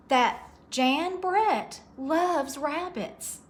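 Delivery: usual speaking voice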